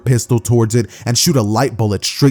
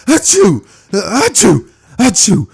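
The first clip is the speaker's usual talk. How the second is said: high-pitched mock sneeze; a string of loud, high achoos; acted sneeze; 10 semitones higher, 5 dB louder, slower than usual. Deku sneezing from pollen, high mock sneezes. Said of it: "would start coming off from Deku".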